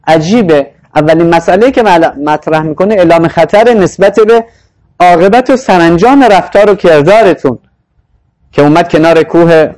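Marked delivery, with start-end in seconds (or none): none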